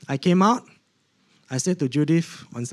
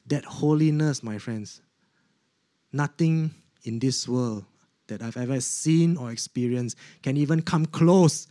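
usual speaking voice

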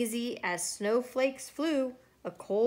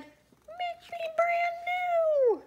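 very high, drawn-out tiny voice; melted snowman's voice; much slower than usual. A narrator's reading. Snowman speaking from the puddle, tiny high voice. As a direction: stretch it out slowly, way up high. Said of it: "A voice from the puddle said".

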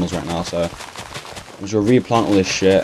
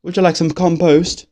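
higher and slow, a teasing posh offer of "compost"; mock offering; 8 semitones higher than usual. Gamer mocking the American word, slow high mock offer. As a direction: put on a mocking waiter's voice. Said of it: "It sounds like you're taking the piss".

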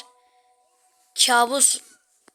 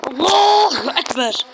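loud, drawn-out cry; loud slow exclamation; 3 semitones higher, 7 dB louder, slower than usual. Real, not acted. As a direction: belt it out in relief. Exclaiming while escaping.

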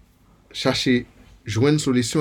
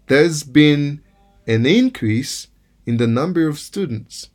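increase stressed on IN, a little louder; noun stress on IN; 4 semitones higher, 3 dB louder, slower than usual. Shown as a demonstration. Teacher reading the noun example, stress on IN. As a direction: lean on the IN of increase. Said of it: "Lòske se yon non".

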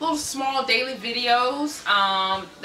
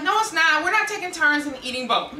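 mock refusal; 7 semitones higher than usual. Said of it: "I'm like".

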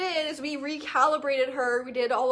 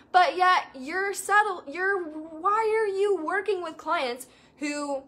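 higher, louder, slow and incredulous; exasperated scolding; 3 semitones higher, 3 dB louder than usual. Retold or imitated. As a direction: scold her, rising to stop stop stop. Coach retells scolding a client.

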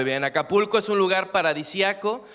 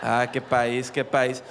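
much lower, rueful aside; 10 semitones lower, slower than usual. Acted. Comic commenting after the border joke, low mock lament. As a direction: drop right down for a mock lament.

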